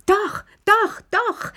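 very high, insistent cries; shrieking. A narrator's reading. Lilli clapping her hands and insisting, high excited shriek. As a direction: squeal it out like an excited child. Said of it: "schrie Lilli".

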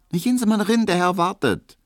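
high, loud dismissive maid voice; brusque maid. Acted. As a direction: shoo him off as the pert maid. Hedwig weist ihn ab. (hohe, laute schnippische Magd)

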